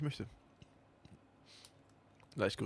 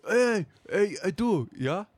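higher, louder clueless customer; shop customer voice; 6 semitones higher, 3 dB louder than usual. Acted. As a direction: ask the clerk like a confused shopper. Acts a customer asking for help.

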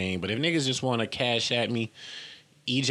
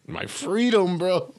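high, louder scoffing echo; mocking; 10 semitones higher, 4 dB louder, slower than usual. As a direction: throw it back in disbelief.

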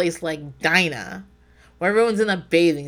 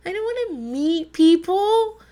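incredulous balk; 9 semitones higher, slower than usual. Retold or imitated. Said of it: "I'm like".

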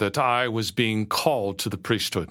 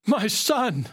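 screamed; 11 semitones higher than usual. A narrator's reading. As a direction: cry out high in joyful shock, short of a scream. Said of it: "she screamed".

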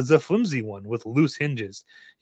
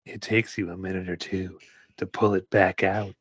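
low straining voice; 6 semitones lower, 6 dB quieter than usual. Shown as a demonstration. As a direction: lower and softer, strained. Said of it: "repeats line in other voice".

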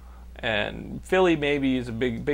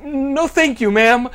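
high, loud, slow mannerly no; rattled polite refusal; 10 semitones higher, 7 dB louder, slower than usual. Acted. Young man turning down a hooker, flustered politeness. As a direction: decline with strained, drawn-out courtesy. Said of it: "And I go".

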